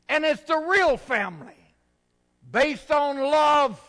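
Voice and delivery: loud, high preaching at full force; forceful preaching. Acted. Pastor thunders to his congregation, loud and high.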